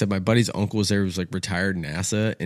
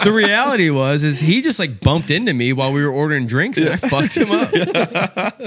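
louder, higher, last words slowed; loud comic confession; 6 semitones higher, 4 dB louder than usual. Real, not acted. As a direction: hammer the punchline.

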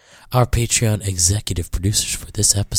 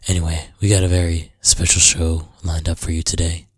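usual speaking voice

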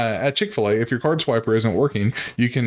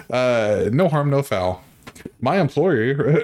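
ordinary talk

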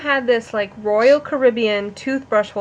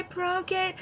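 small, high, quiet whimper; pitched-up pitiful little wail; mock crying; 8 semitones higher, 11 dB quieter than usual. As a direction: imitate a tearful kid confessing. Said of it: "you're crying".

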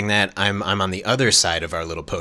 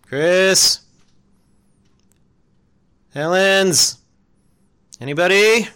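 calling out; 9 semitones higher, 5 dB louder, slower than usual. Acted. Calling out alone.

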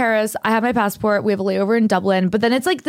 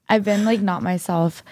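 ordinary talk, nothing marked

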